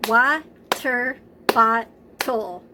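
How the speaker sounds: slow, syllable by syllable, loud; clapped syllables. Shown as a demonstration. Teacher clapping a word out with the class, slow and syllable by syllable. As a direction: clap it out slowly, one beat per syllable. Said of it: "Let's do water bottle together".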